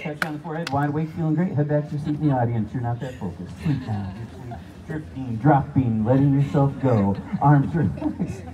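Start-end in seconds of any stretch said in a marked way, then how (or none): 3.6-8.4 slow soothing sleep commands; hypnotic induction. Acted.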